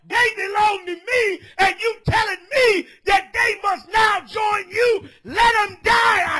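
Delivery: shouted, high street sermon; fiery preaching. Acted. Street preacher scolding a brother, shouted preaching. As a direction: preach it full force at the crowd.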